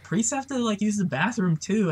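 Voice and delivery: high-pitched imitation of a mom; mom impression. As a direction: mimic a mother explaining the obvious. Mom's voice retold.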